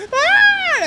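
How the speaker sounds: sudden high-pitched delighted shriek; little-girl scream. Retold or imitated. Sighting a yellow Aventador, he shrieks high and sudden. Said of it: "I screamed like a little girl".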